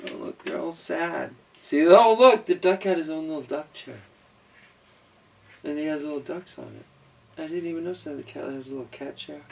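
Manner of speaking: ordinary delivery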